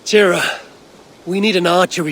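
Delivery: loud call. Acted. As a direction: call her name loud and high. Calling out to someone.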